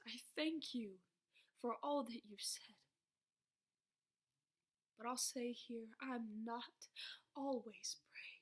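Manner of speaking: soft unvoiced whisper. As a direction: breathe it, barely there. Tired, intimate confession.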